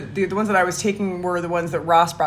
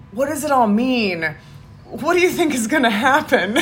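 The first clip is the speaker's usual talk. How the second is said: mock existential angst; 6 semitones higher, 3 dB louder than usual. Recounting anxious questions to a friend, mock existential angst.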